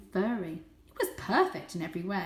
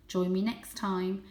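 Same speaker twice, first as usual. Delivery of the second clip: ordinary delivery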